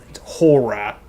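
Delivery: "horror" with the final R dropped, like "hor-ruh"; Maine pronunciation. Shown as a demonstration. Streamer shows chat the Maine "horror", R dropped. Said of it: "That's not really how we say it".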